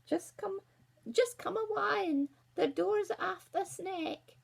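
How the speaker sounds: high, slow put-on granny voice; wolf sweetly faking a granny, drawn out; a wolf disguised as a frail granny, high and slow. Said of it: "the wolf said".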